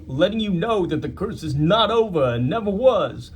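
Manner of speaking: a lightly voiced New York tough guy; New York accent. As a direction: read it as Babe Ruth, New York style. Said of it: "I don't know if you should do that voice".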